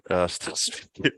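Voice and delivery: ordinary talk, nothing marked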